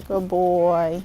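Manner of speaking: low, soft murmur to the horse; soft, low praise for the horse. Real, not acted.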